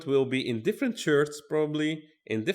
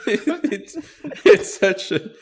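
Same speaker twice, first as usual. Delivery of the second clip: higher, louder, broken up by laughter; laughing; 8 semitones higher, 4 dB louder, much slower than usual. Real, not acted. Reacting to a horrible back joke, laughing. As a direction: try to speak through laughter. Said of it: "I was already laughing before".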